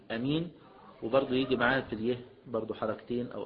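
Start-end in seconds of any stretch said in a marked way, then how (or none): 0.1-0.5 أمين said with a short first a and no long alif; alif dropped. Shown as a demonstration. Teacher showing آمين without its long alif. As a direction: clip the opening of أمين to a plain short a. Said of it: "فممكن يقول".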